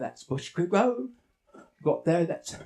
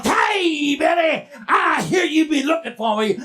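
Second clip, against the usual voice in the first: loud, high monster holler; menacing bushman; 8 semitones higher, 5 dB louder than usual. Acted. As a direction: holler a taunt at your prey. Monster confronts the boy.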